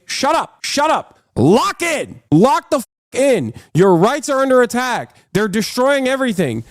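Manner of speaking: high, forceful, urgent shouts; heated shouting. Real, not acted. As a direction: hammer it home angrily. Heated rant at critics.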